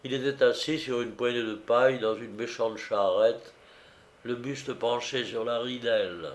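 ordinary delivery, nothing marked

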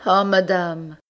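high, loud despairing cry; anguished cry. Acted. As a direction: cry out from your knees. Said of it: "cria Rose toujours agenouillée".

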